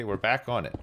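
ordinary talk

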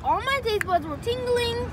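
squealing about the sourness; excited. Real, not acted. After sour candy.

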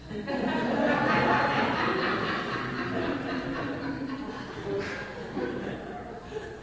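real laughter at the punchline; hearty laugh. Real, not acted. Preacher laughs at his own parrot joke.

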